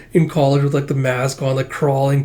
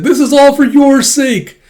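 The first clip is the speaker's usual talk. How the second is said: much higher, louder and slow mock heroic cry; hammed-up declaration; 12 semitones higher, 9 dB louder than usual. Hero charges into the enemy base with a heroic shout. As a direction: bellow it like an anime hero.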